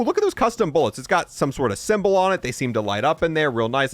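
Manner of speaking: ordinary delivery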